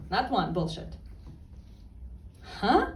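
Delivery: ordinary delivery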